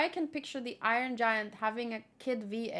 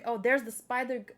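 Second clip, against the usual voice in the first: normal talking voice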